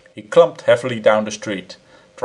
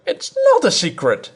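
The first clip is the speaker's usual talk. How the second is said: much higher woman's voice; cried; 12 semitones higher, 3 dB louder than usual. A narrator's reading. Wife to her husband, a high indignant cry. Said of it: "she cried".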